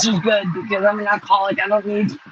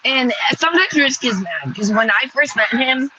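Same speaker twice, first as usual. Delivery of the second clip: usual speaking voice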